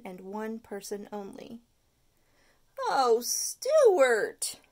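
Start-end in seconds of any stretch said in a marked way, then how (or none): 2.9-4.6 a touch of louder dismay; disappointed cry. Acted. Parents disappointed at Stuart, mild cry. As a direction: sigh out his name in letdown. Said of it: "cried a disappointed mother and dad".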